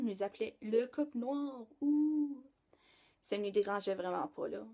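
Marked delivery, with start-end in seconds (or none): 0.6-2.4 higher, drawn-out mock-spooky nickname. Girl repeating what everyone called her and Braden, mock-spooky. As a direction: make the nickname sound spooky, ending on a high "ooh".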